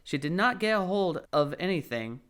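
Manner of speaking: ordinary delivery, nothing marked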